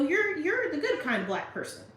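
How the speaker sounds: high, loud put-on voice; exaggerated high-pitched fake compliment; mock patronizing compliment.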